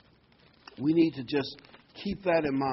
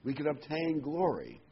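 ordinary delivery

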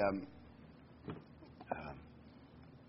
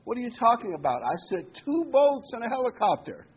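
higher, louder, exasperated; God scolding the man who ignored help; God exasperated at the drowned man, higher and louder; 10 semitones higher, 5 dB louder than usual. Acted.

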